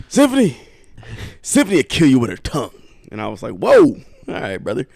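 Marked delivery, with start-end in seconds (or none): 0.1-2.8 higher and louder, then faster; dad boasting about his daughter; hammed-up dad hype. Dad hypes sister, high pitch. 3.5-4.9 playing it shocked at dad's words; mock surprised. Reacting to dad, put-on voice.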